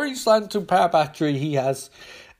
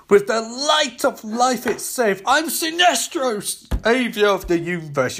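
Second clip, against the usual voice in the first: Sinestro's grand boast; 8 semitones higher, 3 dB louder than usual. Acted. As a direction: stretch out the boast like a proud tyrant.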